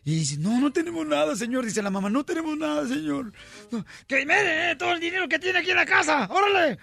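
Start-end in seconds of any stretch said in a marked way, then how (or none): 0.4-1.6 higher-pitched mock pleading; pleading mother. Mother tells robber they have nothing, mock pleading. 2.1-3.3 higher-pitched mock plea; pleading mother. Mother begs the robber to believe her, mock pleading. 3.7-6.8 high-pitched acted shout; robber's shouted demand. Robber demands all the money in the house, shouting.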